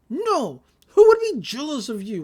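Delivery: high, loud mock-indignant retort; indignant denial. Accused of jealousy, she indignantly denies it. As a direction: protest too much, imitating her.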